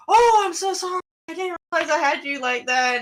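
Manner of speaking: high, gushing imitation of an apology; mock apology. Drunk passenger apologising to the driver, high mock contrition. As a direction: overdo the drunk's sorry, pitched well up. Voicing his exaggerated apology.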